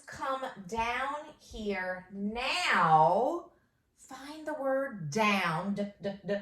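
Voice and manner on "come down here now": slow dictation. Shown as a demonstration. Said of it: "dictating slowly".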